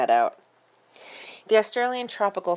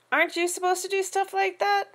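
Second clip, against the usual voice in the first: put-on indignant kid; 12 semitones higher than usual. A narrator's reading. Boy protests cleanup duty.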